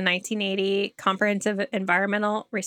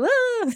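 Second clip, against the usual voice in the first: mock falling scream.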